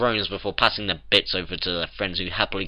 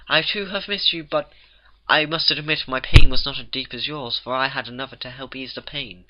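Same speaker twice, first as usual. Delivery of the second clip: higher, theatrical ladylike voice; Rarity; 5 semitones higher, 6 dB louder than usual. A narrator's reading. Rarity pulls the farmer up, refined dramatic confession. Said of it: "now speaking with the right accent".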